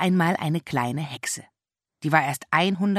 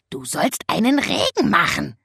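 a touch higher, reproachful raven voice; reproachful raven croak; 4 semitones higher, 4 dB louder than usual. A narrator's reading. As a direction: scold like a cranky raven, louder. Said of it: "krächzte er vorwurfsvoll". (vorwurfsvoll krächzender Rabe)

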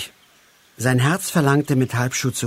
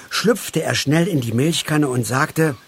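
ordinary delivery, nothing marked

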